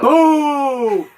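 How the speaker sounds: long, loud, high-pitched boo; heckling boo. Pretend. Heckler booing a comic who asked for it, loud drawn-out boo. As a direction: boo like a rowdy crowd. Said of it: "Boo me if you think it's bad".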